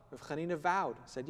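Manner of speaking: normal talking voice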